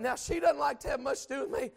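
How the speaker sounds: ordinary delivery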